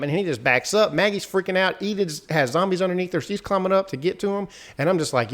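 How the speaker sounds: ordinary talk, nothing marked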